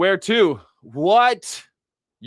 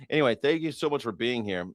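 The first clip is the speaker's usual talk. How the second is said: normal talking voice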